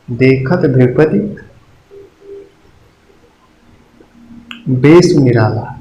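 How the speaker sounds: slow देखत भृगपति, then a long pause before बेश निराला; slow recitation. Shown as a demonstration. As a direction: recite the line slowly, like a verse. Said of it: "example देता हूँ". (धीमा काव्य पाठ)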